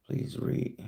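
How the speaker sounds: breathy whispered repeat; host whispering a viewer's chat request while reading. Retold or imitated. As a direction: whisper the request back to yourself.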